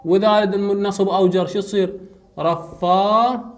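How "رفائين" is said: رفائين slowly, ending held apart; drawn-out demonstration. Shown as a demonstration. Teacher shows students the plural ending, slow drawn-out demonstration. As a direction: split and stretch رفائين.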